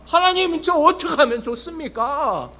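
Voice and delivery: pleading prayer. Retold or imitated. Pastor kneeling before God for guidance, pleading prayer. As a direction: call out to God for help, as retold.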